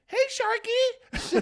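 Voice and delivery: very high, slow, loud cat call. Shown as a demonstration. Proving any name works on the cat, high-pitched call. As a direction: coo it way up high, drawn out. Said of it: "say it in that tone".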